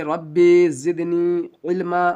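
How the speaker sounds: slow, drawn-out Arabic supplication; recited prayer. Shown as a demonstration. Teacher reciting the dua for knowledge, slow chant. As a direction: chant the short prayer slowly. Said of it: "اور اس دعا کو بھی پڑھ لیں گے".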